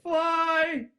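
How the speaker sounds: very high, loud cry of fly; high loud gleeful command; excited shout. Retold or imitated. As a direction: yell it with pure glee. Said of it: "you're like".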